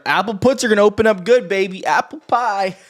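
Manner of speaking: loud, high-pitched trading hype; excited hype. Real, not acted. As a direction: crow the call out to the crowd. Hyping his trade call on stream.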